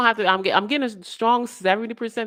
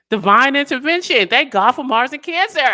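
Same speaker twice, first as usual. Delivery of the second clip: louder, higher, triumphant; exultant declaration; 6 semitones higher, 9 dB louder than usual. Real, not acted. Reader celebrating the reading, loud exultant declaration. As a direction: proclaim it with joy.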